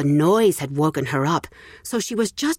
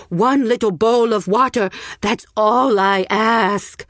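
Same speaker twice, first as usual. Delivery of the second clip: slow, louder pleading beggar; croaking beggar; 5 semitones higher, 4 dB louder than usual. Acted. Old beggar pleading with the village, croaking plea. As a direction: beg for one little bowl. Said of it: "he croaked".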